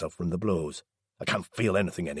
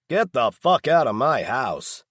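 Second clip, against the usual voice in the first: higher and louder, slow furious shout; angry shout; 6 semitones higher, 6 dB louder, slower than usual. A narrator's reading. Daryl orders Laz out of his house, furious shout. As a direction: order him out at full voice.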